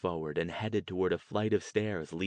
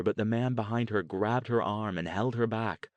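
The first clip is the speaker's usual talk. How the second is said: plain reading voice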